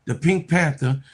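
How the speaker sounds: normal talking voice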